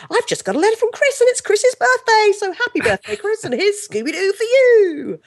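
put-on high, over-excited children's telly host; hammed-up, squeaky-high birthday shout-out; kids' TV presenter. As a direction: gush it out like a manic kids' TV continuity presenter. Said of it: "it was all the continuity presenting between shows".

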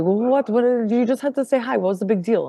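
mock baffled self-justification; imitating defensive mother. Mother defending herself when challenged.

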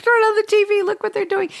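imitating grandma calling to the room; grandma's voice. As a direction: call out high like an excited grandma. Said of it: "And she'd say".

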